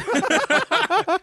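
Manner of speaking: squealing laugh; high burst of laughter. Real, not acted.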